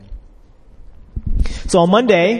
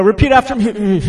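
strained, higher teacher's call, half laughing; struggling to stand; 4 semitones higher than usual. Retold or imitated. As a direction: imitate teaching while your legs give out. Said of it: "My, my knees started buckling".

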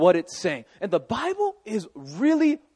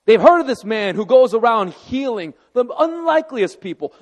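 ordinary delivery

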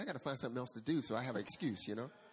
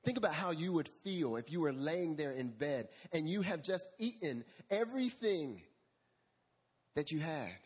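ordinary delivery

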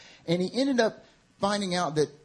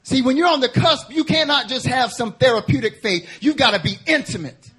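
high, loud, urgent preaching of God's plea; full-force preaching; 8 semitones higher, 7 dB louder than usual. Acted. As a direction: preach it as a cry to the congregation.

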